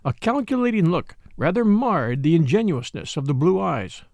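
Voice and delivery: ordinary delivery, nothing marked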